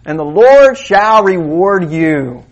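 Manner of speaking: high, loud, slow proclamation; preaching climax. Acted. The preacher proclaims the scripture to his congregation, slow and loud. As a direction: declaim the promise to the crowd.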